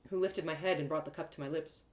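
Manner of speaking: ordinary delivery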